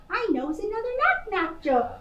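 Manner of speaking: fast cheeky chatter; quick eager. Acted. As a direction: rush it playfully. Playful girl.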